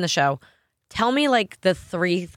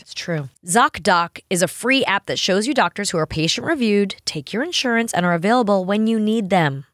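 upbeat sponsor read. Acted. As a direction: sell it to the listeners. Reads a sponsor ad.

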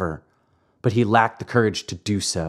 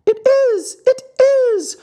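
very high, loud squealing shriek; 6 dB louder than usual. A narrator's reading. Signora Rosaura peeks in from the side, shrieking very high. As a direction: squeal it out from the wings. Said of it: "shrieked Signora Rosaura".

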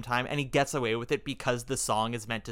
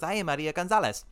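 Maria Gonzalez; 5 semitones higher than usual. Retold or imitated. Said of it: "her thick accent".

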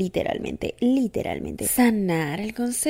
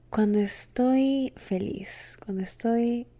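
soft slow whisper; 5 dB quieter, slower than usual. Real, not acted. Host whispers tenderly to her listener. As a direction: breathe it, intimate and slow.

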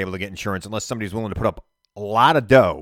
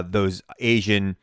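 usual speaking voice

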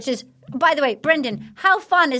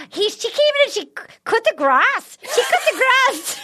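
high-pitched mock Australian; Australian accent; 8 semitones higher than usual. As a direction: squeal it in a hammed-up Aussie accent. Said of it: "wonderful accents like that".

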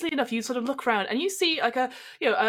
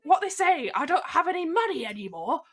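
much higher, hammed-up panic about going broke; a star spiralling into mock panic at the gossip; panicking Jason; 6 semitones higher than usual. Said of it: "and Jason's like".

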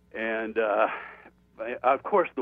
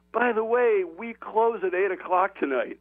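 border official calling out; 8 semitones higher than usual. Retold or imitated. Guard calls after departing driver.

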